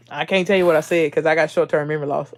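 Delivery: normal talking voice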